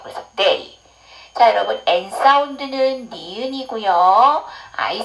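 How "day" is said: "day" low, with a dark E gliding into I; dark E demo. Shown as a demonstration. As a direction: model "day" with the dark E vowel. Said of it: "여기서 어두운 애예요". ("day"를 어두운 애 발음으로 낮게)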